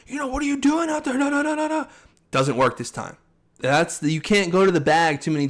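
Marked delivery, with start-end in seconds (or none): 0.1-1.9 high, fast mock scolding; hammed-up coach tearing into his fighter. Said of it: "His coach is like".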